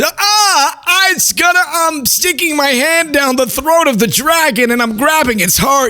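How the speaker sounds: wild-eyed and dreamy, sure of the vision; tripping person. Retold or imitated. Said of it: "and it's".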